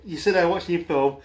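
usual speaking voice

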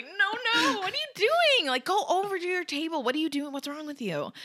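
high-pitched flustered telling-off, fading at the end; exasperated scolding. Acted. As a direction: shoo them off in mock disbelief. Acts Hermione shooing classmates away.

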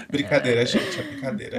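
laughing it off. Real, not acted. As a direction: giggle through the words.

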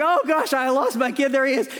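high, loud burst of relief; frantic relief. Retold or imitated. Dad finding his lost son, loud relieved outburst. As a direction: blurt it out, the panic gone in one breath. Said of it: "I was like".

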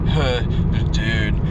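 a hint of a dopey stoner drawl on huh dude; mild stoner kid impression; lightly voiced stoner kid. Shown as a demonstration. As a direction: lazily mimic his own stoner-kid sound. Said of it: "I sound like a fucking prepubescent stoner kid".

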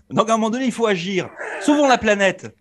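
every phrase ending on a rising pitch; uptalk. Acted. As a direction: finish each line high, like a militant's appeal. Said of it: "intonation, euh, montante à la fin de ses phrases". (intonation montante en fin de phrase)

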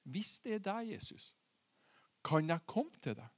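very soft, careful question; acted humble, quiet request; hushed tentative plea. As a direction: ask it low, almost under your breath. Peter tentatively asks Jesus on water.

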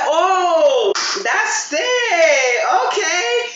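mock excitement. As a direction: overdo the thrilled beginner. Mock excitement while playing pool.

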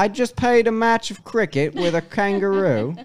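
loud, broad mock Australian; Steve Irwin. Co-host demands the impression, guest gives hammed-up Australian. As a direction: spin a yarn in a hammed-up Aussie accent. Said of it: "Talk like Steve Irwin".

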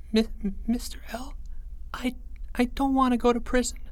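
put-on high, hushed frightened kid voice; a scared boy whispering a confession; high childlike whisper, afraid. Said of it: "he whispered".

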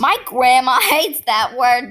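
normal talking voice

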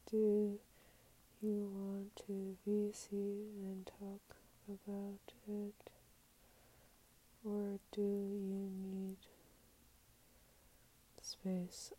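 very slow, drawn-out words fading soft; slow, halting murmur to yourself; typing it out. Real, not acted. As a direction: say it piece by piece as you type, trailing to a whisper. Sounding out words while typing reply.

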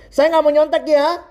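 high, hammed-up righteous shout; retold classroom yell. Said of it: "terus dia teriak di kelas".

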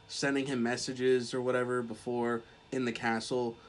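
usual speaking voice

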